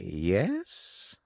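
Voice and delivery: normal talking voice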